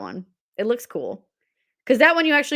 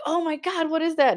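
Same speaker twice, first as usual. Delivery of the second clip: startled; 8 semitones higher than usual. Retold or imitated. Teen spots lights in sky.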